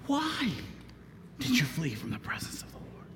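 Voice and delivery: whispered, breathless question. Acted. Mariners ask Jonah fearfully, whispered. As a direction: drop to a whisper.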